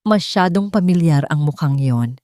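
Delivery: ordinary delivery, nothing marked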